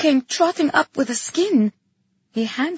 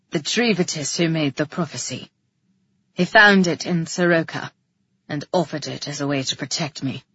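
low male character voice; deep-pitched man justifying himself; defensive Calyx; 7 semitones lower than usual. A narrator's reading. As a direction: explain gravely, pitched well down.

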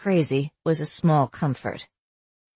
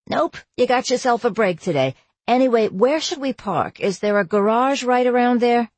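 cheerful mom; 6 semitones higher than usual. Acted. Mom on the phone.

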